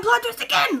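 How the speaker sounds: very high, loud mock exclamation; hammed-up squeal of excitement; escalating mock surprise. Mimics twists piling up, escalating.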